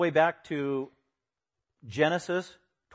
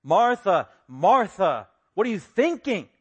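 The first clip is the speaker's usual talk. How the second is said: loud, high, exasperated scolding; imitated rebuke; 6 semitones higher, 8 dB louder, slower than usual. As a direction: call out the name twice, then scold. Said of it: "say to rebuke him".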